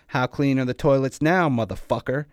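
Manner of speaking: enraged, mocking shout; angry taunt. A narrator's reading. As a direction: throw the question at a beaten enemy, yelling. Enraged attacker taunting his victim.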